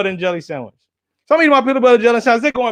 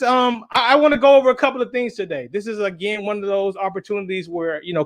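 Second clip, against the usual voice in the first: normal talking voice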